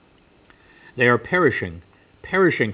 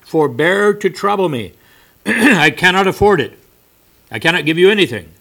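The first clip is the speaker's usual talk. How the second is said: sharp, louder, higher impatient refusal; vexed; 8 semitones higher, 11 dB louder than usual. Acted. Vexed merchant snapping at the persistent stranger, loud and impatient. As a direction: brush him off, clearly irritated. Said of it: "The merchant was a little vexed".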